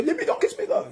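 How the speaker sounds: very high, mock girlish squeal; squeaky protest. Wife squealing at husband, high mock protest. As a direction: squeal it like a shy wife refusing. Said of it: "she say".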